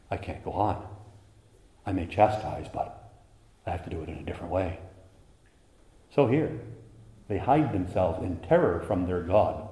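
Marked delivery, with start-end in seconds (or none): 0.1-4.8 relenting father. Acted.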